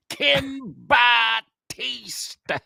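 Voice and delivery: name stretched into extra syllables, very high; Howard Callis. Acted. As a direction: draw each syllable way out like an announcer. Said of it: "made a two-syllable name five syllables".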